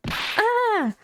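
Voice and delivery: high mock cry of fright; frightened yelp. Sidekick faced with a roaring tiger, high yelp.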